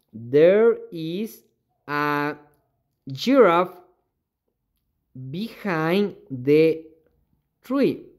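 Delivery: slow, careful dictation of an English sentence; drawn-out teaching read. Shown as a demonstration. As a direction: lay out each word for the class. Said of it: "teacher reads example slowly".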